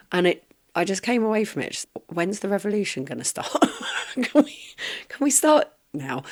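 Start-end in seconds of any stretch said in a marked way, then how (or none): 2.1-6.2 high-pitched, breaking into laughter; laughing exasperation. Real, not acted. Fed-up storyteller joking about starting the revolution, laughing. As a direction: laugh helplessly through the words, pitch climbing.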